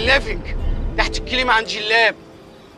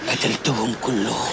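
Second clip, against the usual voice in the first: menacing mutter; 9 semitones lower, 7 dB quieter, slower than usual. Acted. Menacing low line.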